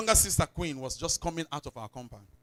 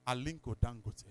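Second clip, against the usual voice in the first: ordinary talk, nothing marked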